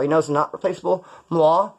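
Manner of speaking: ordinary talk, nothing marked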